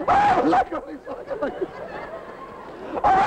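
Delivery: very high, laughing delight. Acted. Chef cooing over the onions, high and delighted. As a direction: squeal with glee over them.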